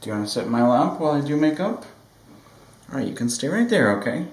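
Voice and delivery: pet talk. Real, not acted. Talking to her whining cat, high cooing baby voice.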